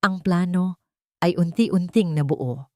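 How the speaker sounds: usual speaking voice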